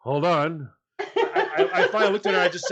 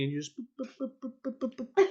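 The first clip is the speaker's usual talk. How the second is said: button beeps; 4 semitones higher, 7 dB quieter, faster than usual. Retold or imitated. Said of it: "She's like".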